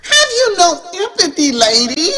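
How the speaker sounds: high, offended reproach; an indignant, high-pitched rebuke. A narrator's reading. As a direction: scold her, voice up high in offense. Nicole reproaches Stephanie indignantly.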